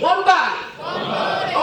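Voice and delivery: high, loud rallying shout; shouted like you mean it. Shown as a demonstration. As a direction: yell One body with full conviction. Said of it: "say it like you mean it".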